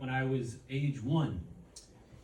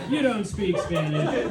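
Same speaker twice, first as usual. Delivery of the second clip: loud, slow, higher mock kid announcement; nine-year-old's declaration; 4 semitones higher, 7 dB louder than usual. Nine-year-old to people in Madrid, loud slow declaration. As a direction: declare it slowly and loudly to strangers. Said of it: "I was just this nine-year-old".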